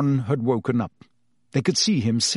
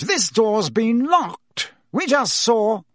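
slow, higher insistence; lightly voiced insistent Fudge; insisting minister; 10 semitones higher, 3 dB louder, slower than usual. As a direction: stress the obvious fact.

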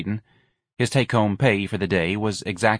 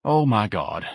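weary, understated sigh of dismay; a touch of put-upon weariness; sighing exasperation; slower than usual. Acted. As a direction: sigh the words with mild resignation. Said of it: "Ignatius sighed".

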